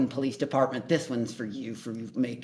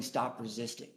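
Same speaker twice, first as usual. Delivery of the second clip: ordinary talk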